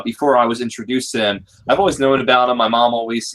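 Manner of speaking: ordinary delivery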